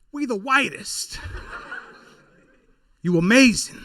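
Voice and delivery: slow, hammed-up hype-man boast. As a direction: puff yourself up like a hype man. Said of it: "look in the mirror like".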